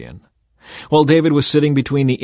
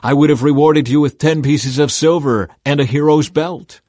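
louder, higher indignant rebuke; demanding; 4 semitones higher, 6 dB louder than usual. A narrator's reading. Joab to the man who spared Absalom, demanding. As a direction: demand an answer with force. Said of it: "Joab demanded".

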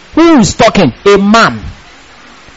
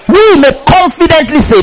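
ordinary delivery

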